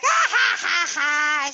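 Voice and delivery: loud, high mock guffaw; imitated laughter. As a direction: burst out in a big retold laugh. Retells nail girl laughing at her.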